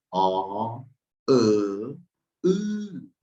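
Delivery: "O", "E", "U" each said apart and exaggerated; vowel review. Shown as a demonstration. Teacher reviewing the vowels with the class. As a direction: drill the three vowels clearly.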